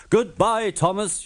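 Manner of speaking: high, loud teasing goodbye; calling goodbye. A narrator's reading. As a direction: shout it as you race past. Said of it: "called Bertie as he raced by".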